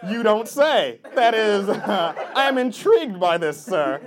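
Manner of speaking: loud, hammed-up interest; overdone polite enthusiasm, loudly projected; mock fascination. Mocks over-keen reply to audience member.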